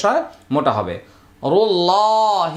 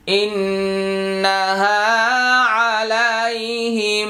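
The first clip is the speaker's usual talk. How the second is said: slow melodic chant; Quranic recitation; 4 semitones higher, slower than usual. Shown as a demonstration. Teacher reciting the verse several times for students, slow chant. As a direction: draw out each word like a reciter. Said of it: "teacher recites verse repeatedly".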